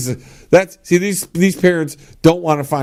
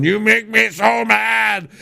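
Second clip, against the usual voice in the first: slow, higher mock-threatening growl; mock threat; 5 semitones higher, much slower than usual. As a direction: threaten the kid to scare him. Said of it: "threaten them a little bit".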